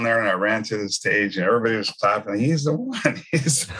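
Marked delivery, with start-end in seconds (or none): 2.4-3.7 high-pitched, breaking up into laughter; laughing mock announcement. Congregation clapping as he runs to stage, mimicked laughingly. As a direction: imitate an onlooker, then crack up.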